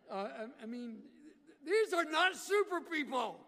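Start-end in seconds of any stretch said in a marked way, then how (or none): 1.7-3.4 loud, high preacher yell. Real, not acted. Preacher yelling at the congregation, catching himself, loud. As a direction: shout it out to the room. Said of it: "Why am I yelling?".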